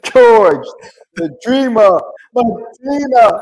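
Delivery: loud, high hype intro; show announcer. Real, not acted. Host welcoming listeners to the podcast, hyped announcer. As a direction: belt out the podcast welcome, stretching the words.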